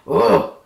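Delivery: short angry bear grunt; mad bear growl. A narrator's reading. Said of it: "he's mad".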